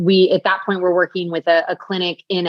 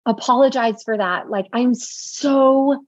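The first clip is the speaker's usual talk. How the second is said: raised, tender reply, slowing on the last line; heartfelt reassurance; 5 semitones higher than usual. Retold or imitated. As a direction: reassure her friend with gentle sincerity. Retelling her reply to her pregnant friend.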